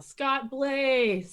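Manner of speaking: calls out the name loudly; yelling a name. Shown as a demonstration. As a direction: shout it across the room. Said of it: "I'm just gonna yell".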